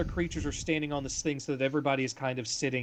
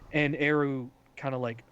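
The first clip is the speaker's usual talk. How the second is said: usual speaking voice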